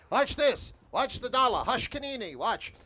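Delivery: sideshow barker. Shown as a demonstration. Sideshow talker building a tip on Coney Island, barking. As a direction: reel the passersby in with a brisk barker call. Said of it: "I'm gonna build a tip like I would normally".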